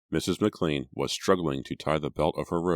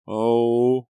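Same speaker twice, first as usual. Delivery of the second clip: higher, louder shocked moan; 8 semitones higher, 9 dB louder than usual. A narrator's reading. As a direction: let out a stricken moan. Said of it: "Erica moaned".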